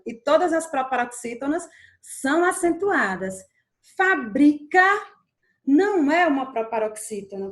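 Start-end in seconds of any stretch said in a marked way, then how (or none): 4.0-4.8 Fabrica said loudly in separate beats, FÁ no longer stressed; stress moved off first syllable. Shown as a demonstration. Teacher contrasting the verb with the industry, Fabrica stressed later in the word. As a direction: lift the later syllables of Fabrica, rising at the end. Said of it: "estou falando de fabricar alguma coisa".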